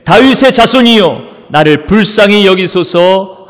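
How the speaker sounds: loud, slow, high congregational reading. A narrator's reading. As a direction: read the plea out at full voice. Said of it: "더욱 크게".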